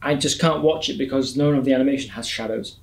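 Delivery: ordinary talk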